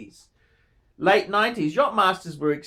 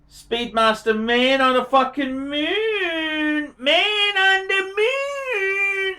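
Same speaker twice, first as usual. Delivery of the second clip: slow, soaring, much higher on Man on the Moon; gushing awe; 9 semitones higher, much slower than usual. Real, not acted. Marvelling at a viewer's Speedmaster, slow soaring awe. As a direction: stretch it out in wonder.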